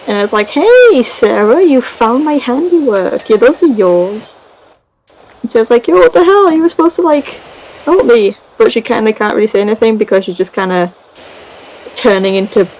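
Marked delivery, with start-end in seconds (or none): 0.5-4.4 high, sing-song mock-friendly greeting; slow, swooping taunt of a pleased villain; gloating villain. Acts gloating villain Eleanor. 5.9-8.4 much higher acted outrage; indignant protest. Acts betrayed Sarah confronting Eleanor.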